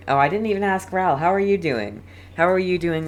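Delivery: ordinary delivery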